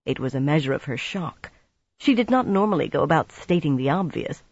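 ordinary delivery

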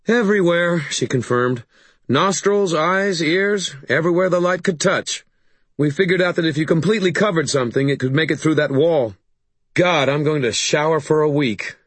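high, lively woman's voice; weary woman covered in mud. Acted. A woman telling Harry about the mud trick, high and animated.

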